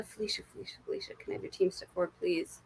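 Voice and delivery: soft breathy call of her name; hushed ritual invocation; whispered summons. Real, not acted. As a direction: call the spirits in a hush. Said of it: "summoning the viewer's guides".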